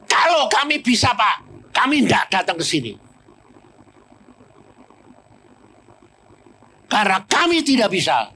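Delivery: omelan istri tinggi dan keras; istri marah. Retold or imitated. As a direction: protes marah kepada pendeta. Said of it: "Dia marah langsung ini". (istri yang marah memprotes pendeta)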